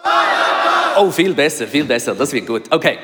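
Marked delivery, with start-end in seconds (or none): 0.0-1.0 loud, unvoiced crowd shout; louder crowd shout. Preacher coaching the crowd, shouting for Barabbas louder. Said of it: "you can do better than that".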